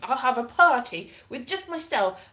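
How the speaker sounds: normal talking voice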